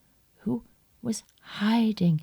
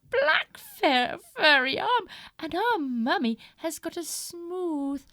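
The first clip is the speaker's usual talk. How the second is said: put-on little kids' voice; 10 semitones higher than usual. Little kids refusing wolf.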